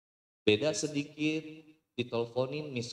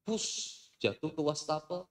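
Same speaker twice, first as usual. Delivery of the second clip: normal talking voice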